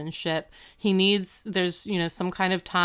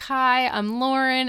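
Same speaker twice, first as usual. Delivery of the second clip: friendly introduction; 6 semitones higher, 3 dB louder than usual. Retold or imitated. Imitates girl introducing herself to parents.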